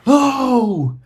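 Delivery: high mock cry of contact; imitated player's yelp. Host showing what refs suddenly hear, mock player's yelp. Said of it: "all of a sudden you hear".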